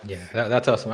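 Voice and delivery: ordinary delivery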